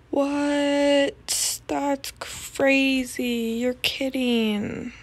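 slow, drawn-out fake astonishment; mock disbelief. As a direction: ham up the shock, stretching every word. Mock shocked reaction to story.

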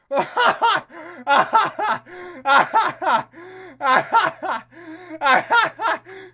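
loud, high cackling in bursts; hysterical laughter. Real, not acted. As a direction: laugh helplessly, gasping between bursts. Overcome by the absurd game.

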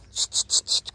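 quick voiceless clucking hisses; repeated short tsch to coax an animal; luring tsch. Real, not acted. (lockende Tsch-Laute)

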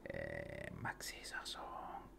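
a quick private whisper of self-praise; whispered aside. Real, not acted. Praising himself under his breath, slow whisper. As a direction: whisper to yourself, slowly.

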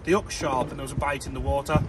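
ordinary talk, nothing marked

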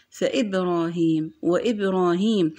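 فإبراهيم، وإبراهيم with a glottal stop before the i; hamza pronounced. Shown as a demonstration. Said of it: "هنا نطقنا الهمزة".

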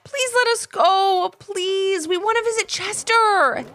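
high, drawn-out pleading; begging kids. A narrator's reading. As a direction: beg like two excited children. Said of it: "repeatedly begged him".

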